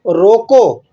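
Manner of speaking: "روکو" alone, firm and cut off; stop on روکو. Shown as a demonstration. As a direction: say روکو and halt there. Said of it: "آپ وقف کرتے ہیں کہاں پر؟".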